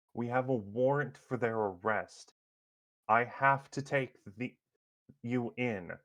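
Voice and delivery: lieutenant appealing to a crowd, voice lifted; urgent plea. Acted. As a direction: plead firmly as the arresting officer. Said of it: "starts making a plea".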